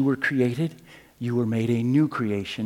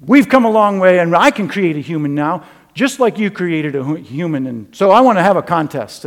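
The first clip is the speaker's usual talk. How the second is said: scientist voice; 5 semitones higher, 11 dB louder than usual. Acted. Scientist challenges God in joke.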